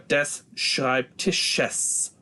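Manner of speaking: "Schreibtisches" very slowly, with a separate final -es syllable; re-formed S ending. Shown as a demonstration. Teacher showing why E is added, "Schreibtisches" slowly sounded out. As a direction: draw out "Schreibtisches", giving the sh and the last s each their own moment. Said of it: "giving myself an opportunity to do that".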